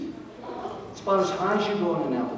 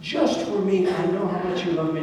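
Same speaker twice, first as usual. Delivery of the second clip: higher, quick, overconfident protest; a churchgoer sure he understands, higher; eager believer; 7 semitones higher, 3 dB louder than usual. Pretend. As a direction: rush in like you already know it all. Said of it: "some of us might be tempted to say".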